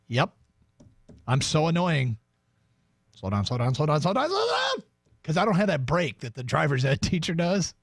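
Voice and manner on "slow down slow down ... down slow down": mock panic, pitch rising with each repeat; panicky nagging. Nervous parent nagging a learner driver, mock panic rising.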